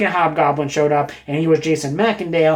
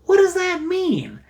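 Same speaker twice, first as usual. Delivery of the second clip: high, drawn-out musing question; puzzled wondering; 13 semitones higher than usual. Retold or imitated. Reader puzzling over a story alone, puzzled wondering. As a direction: wonder it aloud, stretched out high. His own wondering thought after reading.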